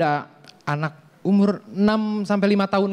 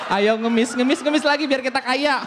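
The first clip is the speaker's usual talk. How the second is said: high, shouted push to beg more; shouted urging; 7 semitones higher than usual. Acted. Father shouts at his child to keep begging.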